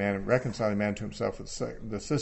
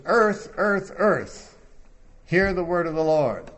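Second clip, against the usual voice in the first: raised, louder prophetic proclamation; strong emphasis; 5 semitones higher, 5 dB louder, slower than usual. Acted. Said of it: "because that's strong emphasis".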